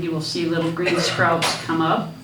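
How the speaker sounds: normal talking voice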